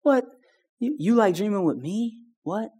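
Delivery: soft, rising wonder; stunned that God enjoys him; amazed disbelief. Retold or imitated. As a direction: ask it in quiet awe. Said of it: "it's like".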